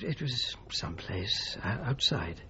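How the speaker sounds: slow, hushed, unvoiced whisper; hesitant, not wanting to say where; reluctant whisper. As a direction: whisper it evasively. Reluctant to name the place.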